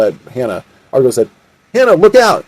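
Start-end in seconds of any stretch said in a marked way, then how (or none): 1.7-2.4 high, urgent call; acted cry of alarm; alarmed warning. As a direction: warn a friend of sudden danger. Warning as a pistol is aimed.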